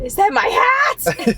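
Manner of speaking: high, slow, disbelieving question; stunned surprise. Retold or imitated. Friend sees his lost hat, slow high disbelief.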